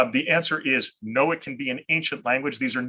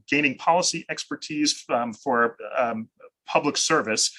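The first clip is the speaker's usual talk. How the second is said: usual speaking voice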